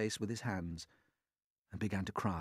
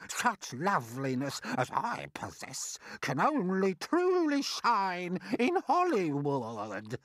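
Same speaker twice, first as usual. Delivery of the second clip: high, louder boast, slowing grandly; grand declaration; 9 semitones higher, 4 dB louder, slower than usual. Acted. Aunt boasting she belongs in Hollywood, grand declaiming. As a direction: declare it like a vain would-be star. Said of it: "Aunt Sponge declared".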